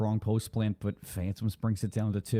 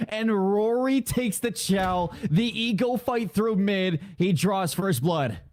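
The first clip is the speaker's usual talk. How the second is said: raised-pitch shoutcast with stretched words; live play-by-play; 9 semitones higher, 4 dB louder, slower than usual. Real, not acted. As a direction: announce first blood with lift.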